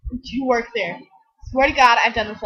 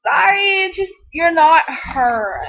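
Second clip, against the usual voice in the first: higher, louder, half-sobbing apology; mock distress; 4 semitones higher, 3 dB louder, slower than usual. Apologising to a stranger she mistook for a friend, hammed-up wail.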